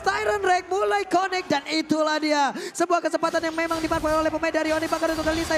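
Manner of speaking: fighting game announcer. Real, not acted. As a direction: call the big play at full hype. Caster hyping a big team fight.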